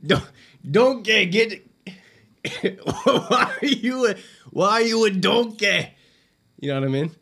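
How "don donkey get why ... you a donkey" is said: loud, high Scottish ogre, drawn out; stretched-out cartoon ogre holler; Shrek impression. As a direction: play an exasperated Shrek, breaking up and restarting. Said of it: "I can do, I can do Shrek".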